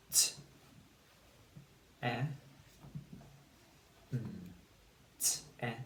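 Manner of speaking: "t", "E", "N" as isolated letter sounds, long gaps between; sounded out. Shown as a demonstration. Teacher builds the second syllable with pupils, sounded out. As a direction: say "t", short "e", "n" one by one. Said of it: "Say the sounds and build the word".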